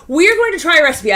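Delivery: usual speaking voice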